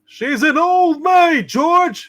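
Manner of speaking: loud, slow, high cry of exasperation; exasperated shout. Acted. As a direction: throw the bad news at him, loud and high. Angel blurts out the shocking news.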